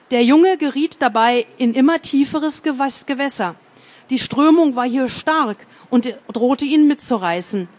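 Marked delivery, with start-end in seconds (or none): none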